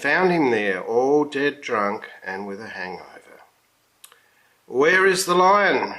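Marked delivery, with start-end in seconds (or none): 4.7-5.9 sharp raised shout, higher and louder. A narrator's reading. Circus manager shouting at the lion tamer. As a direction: bark the question at the drunk tamer. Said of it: "shouted the manager of the circus".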